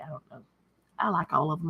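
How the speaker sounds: ordinary delivery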